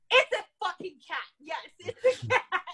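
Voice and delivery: high, loud emphatic retort with a laugh; exasperated insistence. Retold or imitated. Exasperated reply to officer.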